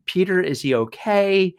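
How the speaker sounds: high, slow anxious question; mock tearful concern; imitating tearful wife. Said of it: "She's in tears".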